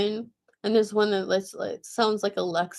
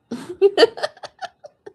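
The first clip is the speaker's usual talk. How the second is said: real laugh; 10 semitones higher, 5 dB louder than usual. Real, not acted. Laughing at the AI's answer.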